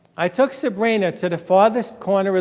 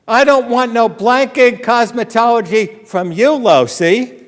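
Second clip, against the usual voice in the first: defiant shout; 5 semitones higher, 4 dB louder than usual. Retold or imitated. Defiant student retorting to teacher.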